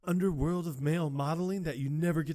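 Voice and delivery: high, loud showman pitch; high excited invite. Retold or imitated. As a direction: sell the secret world loudly. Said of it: "It's like".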